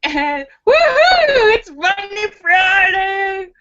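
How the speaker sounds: high, slow, stretched woohoo; excited cheer. Real, not acted. Host cheering her live audience, excited and high. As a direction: whoop it up to kick off the weekend.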